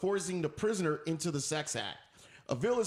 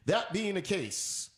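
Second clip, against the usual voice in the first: ordinary delivery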